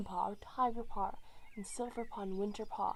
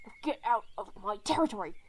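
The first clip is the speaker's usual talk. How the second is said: louder and faster, get out now; angry order; 5 dB louder, faster than usual. A narrator's reading. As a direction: drive them off your territory. Leader driving cats out.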